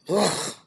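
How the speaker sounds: short exasperated "ugh"; disgusted groan. Real, not acted. Reacting to the earring in her face, disgusted groan. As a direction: groan in distaste.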